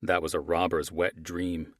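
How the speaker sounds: ordinary delivery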